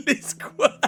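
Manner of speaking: laughing through the words; barely getting words out; crying with laughter. Real, not acted.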